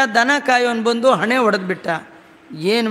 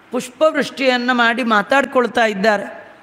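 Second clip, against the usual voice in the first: normal talking voice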